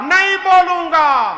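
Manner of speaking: "नहीं बोलूंगा" yelled high and loud, hitting "नहीं"; defiant shout. Acted. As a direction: shout back a stubborn refusal. Son shouts back his refusal. (ज़िद्दी बेटा गुरुजी पर चिल्लाकर मना करता है)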